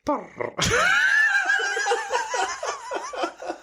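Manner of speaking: high wailing cat noise; cat impression. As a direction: yowl like a cat. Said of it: "you give us your best animal impression".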